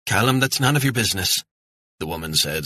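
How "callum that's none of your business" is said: higher, quick Scottish mum telling off her son; scolding mother. Acted. Mother on the ferry scolds her nosy son. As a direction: snap at the boy, fast and sharp. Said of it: "scolding her son".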